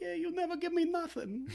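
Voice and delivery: very high whiny Paulie impression; imitating Paulie. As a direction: whine like Paulie begging for a cut. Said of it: "starts doing his bit of".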